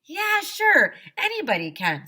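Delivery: high, loud impatient brush-off. Acted. Woodsy answers the pestering kid, impatient high brush-off. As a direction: snap it out, fed up. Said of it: "said Woodsy impatiently".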